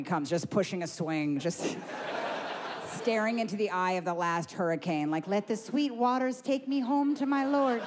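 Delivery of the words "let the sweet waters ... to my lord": serene doomed mother. Acted. Dazed woman greeting the apocalypse.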